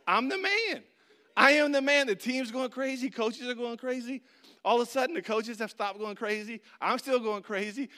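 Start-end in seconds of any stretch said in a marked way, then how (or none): 0.1-2.1 higher, louder bragging; cocky triumph. Retold or imitated. Player in his head after scoring, crowing loud. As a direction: crow like the hero. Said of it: "I just thinking".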